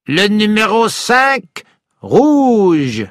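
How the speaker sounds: high, slow French croupier call; casino croupier. A narrator's reading. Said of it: "said the croupier".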